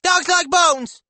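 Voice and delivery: put-on slow, very high and loud little-boy squeak; exclaiming child. A narrator's reading. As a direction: squeal each word out as a small boy. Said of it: "Remington exclaims".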